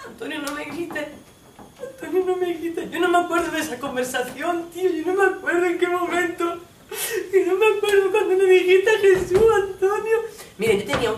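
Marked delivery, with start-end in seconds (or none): 0.1-2.9 high, timid, tearful plea; shrunken, much higher-pitched begging; feeling small. Acted. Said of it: "es sentirme más chiquita". 2.9-10.2 high-pitched whimpering protest; acted sobbing, frightened excuse; tearful pleading. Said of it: "sigue llorando".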